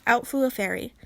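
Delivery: ordinary delivery, nothing marked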